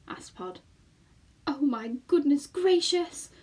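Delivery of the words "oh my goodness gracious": slow, higher, louder lament; moaning. Acted. Homily despairing at leaving home, moaning. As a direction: wail it in dismay. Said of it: "moaned Homily".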